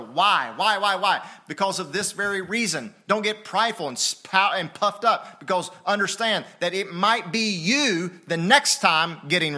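loud, high, driving sermon delivery; full-force preaching. Real, not acted. Pastor challenging the church about meekness, full-force preaching.

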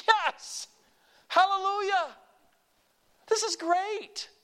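high, loud hallelujah; joyful shout. Real, not acted. Preacher shouting praise over going to heaven. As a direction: burst out in praise.

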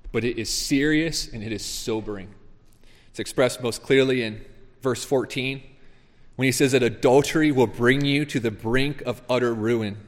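usual speaking voice

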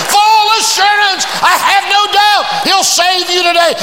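high, loud preaching shout; preacher shouting thanks to the Lord; shouting praise. Acted. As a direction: lift the roof with praise. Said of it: "She started shouting".

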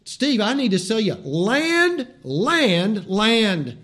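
land, land, land hammered loud and high; pushy land salesman. Pretend. Preacher mock-selling Florida land to Steve, pushy and loud. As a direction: pitch the land like a hard seller. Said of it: "I wanna say".

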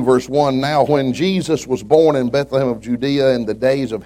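loud, high preacher's Bible reading; scripture reading. Acted. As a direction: proclaim the verses to the congregation with force. Reads scripture aloud.

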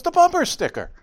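very high, loud repeat; insist, exasperated; insistent daughter. Retold or imitated. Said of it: "She goes".

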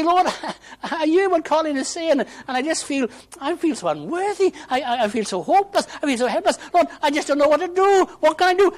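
high, loud, anguished cry to God; desperate plea. Acted. Preacher voicing an unworthy seeker's prayer, desperate cry.

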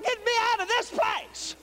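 high, drawn-out cry for help; full-voiced, high-pitched shout to be rescued; desperate holler. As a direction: holler as if trapped in a fire. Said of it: "'cause I'm gonna holler".

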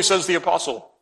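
normal talking voice

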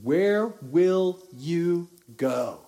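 very slow, each word weighted; slow heavy question. Real, not acted. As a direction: ask it slowly and let it hang. Repeats the rhetorical question.

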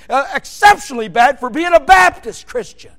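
normal talking voice

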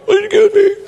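high, loud strangled plea; choking diner. Acted. Diner choking begs another table for help, high strangled voice. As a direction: squeal it as if food is stuck in your throat. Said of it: "It's stuck in my throat".

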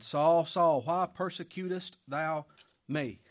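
loud, slow calling voice; preaching the Lord's call. Acted. The Lord calling to Saul on the road, loud and slow. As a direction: call out his name across the road.

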